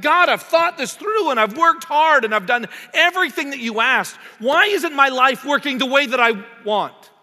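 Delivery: loud, high complaining plea to God; frustrated complaint. Acted. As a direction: whine at God like you've earned it.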